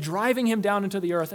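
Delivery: normal talking voice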